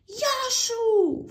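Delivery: very high, loud yelled greeting; shouting hello. Acted. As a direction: yell it across the street. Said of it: "φώναζαν δυνατά". (φωνακλάδικο, ψηλό «γεια σου»)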